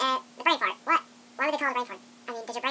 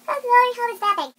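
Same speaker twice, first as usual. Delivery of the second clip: high, loud mock teacher scold; 9 semitones higher, 9 dB louder than usual. Teacher scolding a student napping in class, high loud voice. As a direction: scold him like a fussy teacher.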